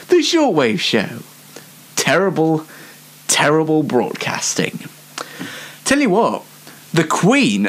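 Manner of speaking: ordinary talk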